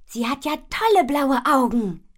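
high, loud gush of admiration; thrilled storybook child; delighted admiration. A narrator's reading. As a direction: admire it with an excited, lifted voice. Admiring the kitten's eyes.

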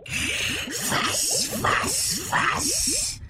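high, breathy hissing creature; hissing monster. Acted. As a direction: hiss it out like a beast. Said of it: "kam es zischend aus dem Maul".

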